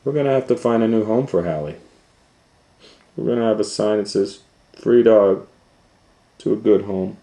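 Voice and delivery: lightly voiced parents. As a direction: rattle off the decision briskly.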